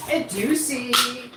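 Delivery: playful tease. Real, not acted. Teasing last ingredient.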